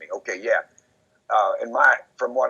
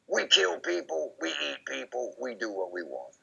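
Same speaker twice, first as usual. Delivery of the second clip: mock boasting; 4 semitones higher, 5 dB quieter than usual. Imitating the pair bragging in public, mild mock boast. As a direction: lightly play the bragging killers. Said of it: "they would say in public and laugh".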